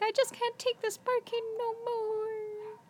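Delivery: high, slow dog voice. Retold or imitated. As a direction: voice the fed-up dogs up high and slow. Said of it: "They're like".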